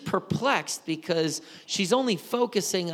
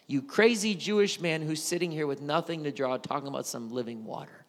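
ordinary talk, nothing marked

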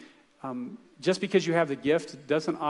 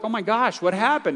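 higher, louder gasp of alarm; shocked reaction; 6 semitones higher, 4 dB louder than usual. Retold or imitated. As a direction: retell the shocked gasp of the listeners. Church folks react to mugging story.

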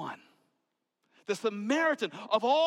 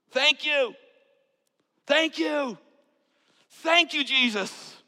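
higher and louder, overjoyed cry; joyful thanks; 8 semitones higher, 6 dB louder, slower than usual. Acted. The healed Samaritan crying thanks to Jesus, loud and overjoyed. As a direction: pour out thanks with no holding back.

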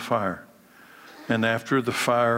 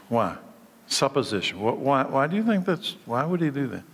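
ordinary talk

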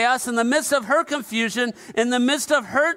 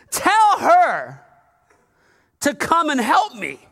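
high, loud demanding sister; a bossy sister giving Jesus orders; demanding Martha; 5 semitones higher, 4 dB louder than usual. Acted. Martha telling Jesus what to do, higher and louder.

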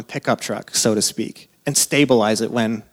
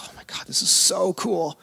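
awestruck hush; 5 semitones higher, 5 dB quieter than usual. Retold or imitated. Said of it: "you're like".

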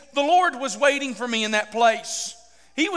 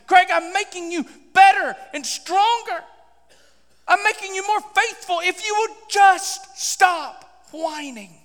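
high, loud, slowing to a dropped last word; exasperated exhortation; 7 semitones higher, 3 dB louder, slower than usual. Retold or imitated. The Lord urging Craig to stop, exasperated exhortation. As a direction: plead forcefully, then let the end fall. God's exhortation, acted by preacher.

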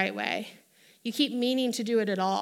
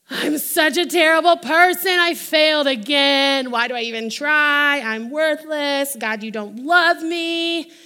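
mock sulking; 2 semitones higher, 7 dB louder than usual. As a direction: whine it out like a sulking child. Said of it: "He doesn't even sulk like".

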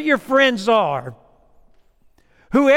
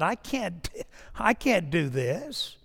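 weary retold complaint; 8 dB quieter, slower than usual. Retelling frustration after reading.

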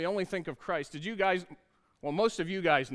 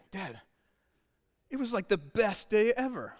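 put-on young son's voice; 4 semitones higher than usual. Son describes his day.

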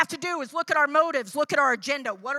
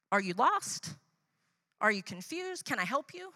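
soft, caring questions to a lost stranger; gentle offer of help; 6 dB quieter than usual. Pretend. To a lost stranger on the stairs, softly caring. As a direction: ease a confused stranger, softly.

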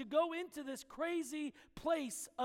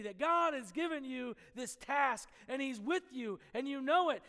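ordinary talk

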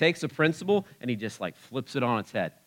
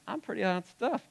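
high, softer mock-smug voice; cocky mimicry; 9 semitones higher, 5 dB quieter than usual. Preacher mimicking the congregation thinking well of themselves, high mock-smug voice. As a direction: tease them in a small, high voice. Mimics a self-satisfied listener.

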